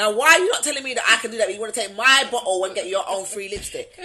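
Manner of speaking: fast, loud mock outrage; indignant. Real, not acted. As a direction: demand an answer, half laughing. Playful outrage over the bottle.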